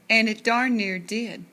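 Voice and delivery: ordinary delivery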